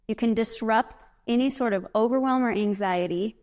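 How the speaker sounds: usual speaking voice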